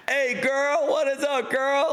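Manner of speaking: high, drawn-out catcalling holler; playful high-pitched catcall; mock catcallers. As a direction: imitate guys hollering at a girl. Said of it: "they're catcalling her".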